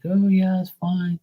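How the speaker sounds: lightly voiced high old auntie; aunt's voice. Nephew's aunt brushing off his question, high aunt voice. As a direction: answer breezily as the aunt.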